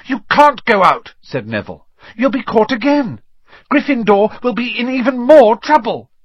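high-pitched, alarmed objection; anxious protest. A narrator's reading. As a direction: protest loudly in a high, worried voice. Neville protests against rule breaking.

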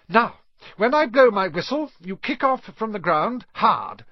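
high, loud instructor's orders; brisk flying instructor. A narrator's reading. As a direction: call out instructions to a class.

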